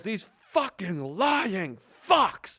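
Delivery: slow, stretched-out angry shout; retold screaming. Imitating an irate passenger as the delay begins, screaming. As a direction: stretch each word into an imitated rant. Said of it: "Screaming immediately out of the gate".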